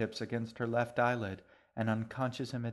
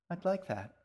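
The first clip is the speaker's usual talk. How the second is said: put-on happy girl; 8 semitones higher than usual. A narrator's reading. G answers happily.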